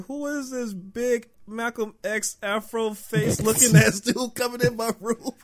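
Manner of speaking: high, laughing mock outrage; laughing disbelief. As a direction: cackle through the insult at the friend. Mocking friend's afro in retold scene.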